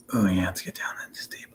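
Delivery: soft breathy whisper reading comments; hushed, unvoiced whisper. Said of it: "is what Wine Boy whispers".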